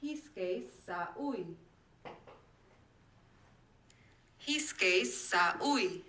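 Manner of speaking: reversed audio, soft and slow; backwards speech. Shown as a demonstration. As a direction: play the words in reverse. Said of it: "Or this".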